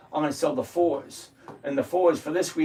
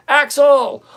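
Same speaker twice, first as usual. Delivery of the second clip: much higher and louder shout of Axel; calling out a name; 12 semitones higher, 12 dB louder than usual. Real, not acted. Streamer spots a regular in chat, loud high greeting. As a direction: hail the viewer like he just walked in.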